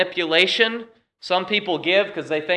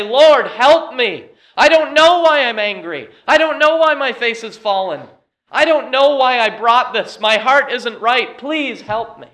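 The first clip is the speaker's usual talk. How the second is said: pleading cry; 7 semitones higher, 5 dB louder than usual. Acted. Cain crying out to the Lord, pleading for help. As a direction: beg the Lord for help, close to tears. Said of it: "for Cain to cry out at that point and say".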